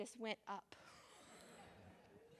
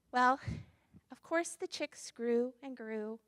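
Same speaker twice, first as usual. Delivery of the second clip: ordinary delivery, nothing marked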